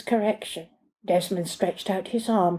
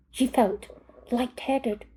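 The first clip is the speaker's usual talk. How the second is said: ordinary delivery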